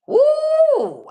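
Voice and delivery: high, loud whoop; whooping woo. Retold or imitated. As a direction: let out a sudden high woo. Sick from withdrawal, exclaiming.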